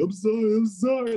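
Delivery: high, pleading mock sorry; pleading apology. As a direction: beg forgiveness in a high voice. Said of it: "And then Netflix is gonna be like".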